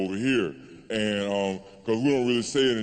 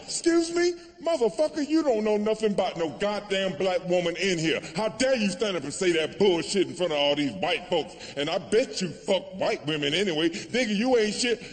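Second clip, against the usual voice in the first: high-pitched, indignant telling-off; acted outraged heckler, pitched high; 6 semitones higher than usual. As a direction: tear into him as an offended woman. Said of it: "She was mad as fuck".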